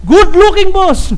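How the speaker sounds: high-pitched piled-on compliments; gushing flattery. Acted. Employee praising the boss's outfit, sucking up. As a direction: flatter the boss item by item, hammed-up.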